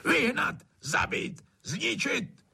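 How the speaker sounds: high-pitched, separate furious bursts; hollering landowner drowning out frogs; hollered commands. A narrator's reading. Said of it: "a hulákal".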